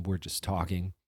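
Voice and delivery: ordinary delivery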